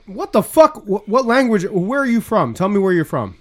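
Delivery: loud, high-pitched exasperated demand; exasperated repeat. Real, not acted. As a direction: raise your voice at someone who didn't understand. Repeats himself to uncomprehending caller.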